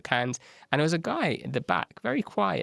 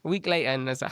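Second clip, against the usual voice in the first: normal talking voice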